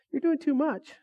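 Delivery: high teasing jab. Retold or imitated. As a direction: poke fun like a big brother. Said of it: "my brother's like".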